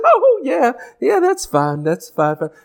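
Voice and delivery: mock overly nice brush-off; sugary fake agreement. Preacher imitating a passive-aggressive person, sugary fake nice. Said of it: "They're just so nice".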